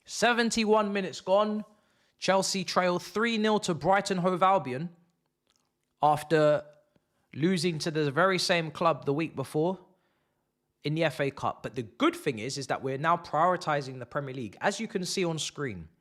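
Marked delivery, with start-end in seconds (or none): 0.0-11.6 commentator read. Acted. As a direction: announce the score like a match broadcast. Mock commentator update.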